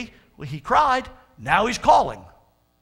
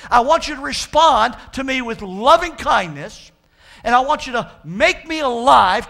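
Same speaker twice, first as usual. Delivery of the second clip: loud, raised prayer, building in pitch; crying out to God; 4 semitones higher, 8 dB louder than usual. Acted. Preacher as psalmist pleading for God's answer, crying out. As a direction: plead forcefully, slowing toward the end. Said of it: "He's crying out to the God".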